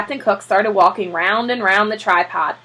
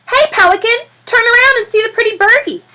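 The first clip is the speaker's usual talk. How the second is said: high, loud sing-song coaxing; high, loud birdie-coaxing voice; coaxing photographer; 13 semitones higher, 6 dB louder than usual. Acted. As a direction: call to the bird like a toddler.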